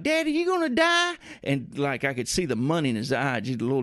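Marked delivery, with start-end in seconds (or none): 0.0-1.1 put-on high, small kid's voice; child voice. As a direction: play the little boy, voice pitched way up. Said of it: "he's like".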